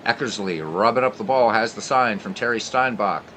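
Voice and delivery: normal talking voice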